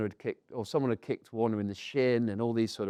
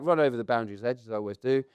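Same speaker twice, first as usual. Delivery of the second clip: ordinary delivery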